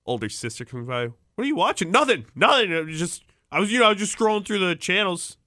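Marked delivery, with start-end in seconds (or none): 1.4-1.9 high nosy sister asking; older sister voice. Retold or imitated. Older sister catches him watching. 1.9-5.4 high, hurried caught-out excuses; flustered denial. Retold or imitated. Caught teen denies.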